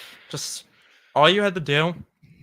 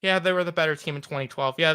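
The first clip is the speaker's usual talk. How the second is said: ordinary delivery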